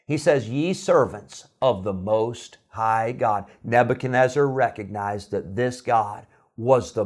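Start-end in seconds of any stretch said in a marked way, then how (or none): 0.5-3.5 slow, drawn-out reverent title; slow solemn title. Retold or imitated. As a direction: let the king's answer land slowly. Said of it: "Nebuchadnezzar answers his own question".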